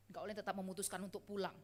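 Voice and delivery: normal talking voice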